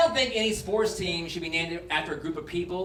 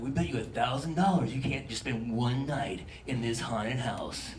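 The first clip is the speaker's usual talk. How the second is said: low, soft, spooky taunt; 8 semitones lower, 6 dB quieter than usual. Acted. Dare quoted.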